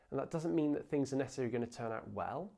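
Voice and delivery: normal talking voice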